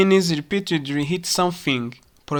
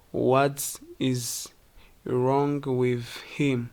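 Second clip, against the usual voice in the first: slow, softer "wrong with him"; clear, unreduced; 3 semitones lower, 6 dB quieter, slower than usual. Shown as a demonstration. Teacher showing the words that stay clear, slow and careful. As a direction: enunciate each word slowly. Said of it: "Stay clear".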